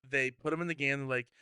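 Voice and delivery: ordinary delivery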